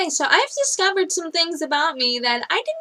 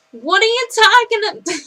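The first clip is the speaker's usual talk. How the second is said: loud, high East Coaster accent, first try; badly faked East Coast accent, breaking off; 7 semitones higher, 8 dB louder than usual. Shown as a demonstration. Showing off an accent and missing it, loud East Coast accent. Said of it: "the East Coaster ones where you're like".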